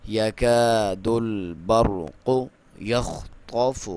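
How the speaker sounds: slow drawn-out tajweed chant; Quran recitation. Shown as a demonstration. Teacher recites the verse for students, slow chant. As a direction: recite it slowly with full tajweed. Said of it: "خا کو clear کریں گے".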